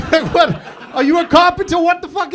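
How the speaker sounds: high, loud baffled outburst; mock incredulity. Comedian challenging the joiner in the crowd, incredulous. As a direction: exclaim in exaggerated disbelief.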